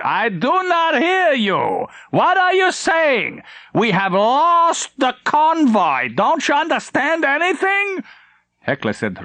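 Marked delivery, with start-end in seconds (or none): 0.0-8.0 high, slow strained bark of fury; a livid admiral demanding answers; angry admiral. Acted. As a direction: admiral storms onto the bridge, high and slow. Admiral raging at officer.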